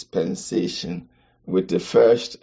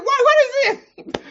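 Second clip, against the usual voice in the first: much higher, louder, baffled squeal; puzzled asking; 4 dB louder than usual. Retold or imitated. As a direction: play a confused voice asking why. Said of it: "I'm saying".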